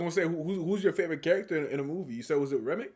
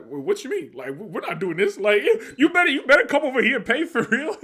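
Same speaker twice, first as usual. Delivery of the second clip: higher, louder rising imitated tirade, breaking into laughter; mock ballistic rant; 10 semitones higher, 9 dB louder than usual. Fan imitating Smoke scolding a customer, mock furious. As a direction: build from stern to shouting like a no-nonsense cousin. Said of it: "he went ballistic".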